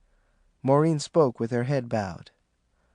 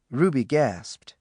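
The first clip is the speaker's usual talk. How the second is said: ordinary delivery, nothing marked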